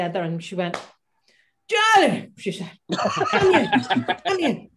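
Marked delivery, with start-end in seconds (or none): 1.7-2.3 high, loud diva call across the room. Retold or imitated. To the nervous young singer, a high loud call. Impersonating [name] greeting her. 2.9-4.7 high, laughing beckon. Retold or imitated. Beckoning the young singer into the room, high and laughing. Impersonating [name] greeting her.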